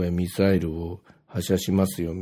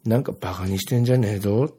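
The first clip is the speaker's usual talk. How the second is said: usual speaking voice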